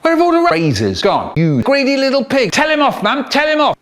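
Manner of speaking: put-on a kid tattling to mum, fed up; fed-up brother. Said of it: "he looks at me all fed up and he says".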